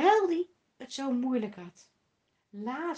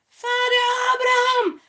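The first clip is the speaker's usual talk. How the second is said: loud, high desperate cry to Abraham; the rich man cries out loud and high for pity; rich man calling out; 11 semitones higher, 10 dB louder than usual. A narrator's reading. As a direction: call up to him across the abyss, begging. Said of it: "riep de rijke man".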